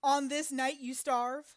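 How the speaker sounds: mocking. Real, not acted. Mocking hungry child.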